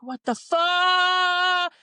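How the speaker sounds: loud, slow, high disbelief; shocked exclamation. Retold or imitated. Hearing coffee drip on the floor, loud slow shock. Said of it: "And I'm like".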